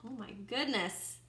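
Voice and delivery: ordinary delivery, nothing marked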